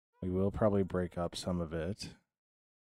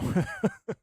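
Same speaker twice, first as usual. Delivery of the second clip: nervous laugh; 12 semitones higher, 6 dB louder than usual. Real, not acted. Laughing after choking.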